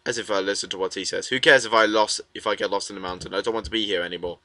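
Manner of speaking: low, soft and fast; hushed rapid grumble; muttered inner thoughts. Acted. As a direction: mutter it quickly to yourself. Reading character's private thoughts.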